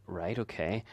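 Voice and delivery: low, quiet, unbothered acknowledgement; unconcerned. Retold or imitated. Dad hearing from his daughter about the hospital, unconcerned. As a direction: take the news without concern, softly. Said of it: "You don't sound too concerned".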